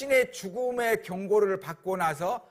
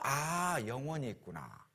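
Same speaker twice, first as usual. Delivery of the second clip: quiet realisation; 3 semitones lower, 9 dB quieter, slower than usual. Retold or imitated.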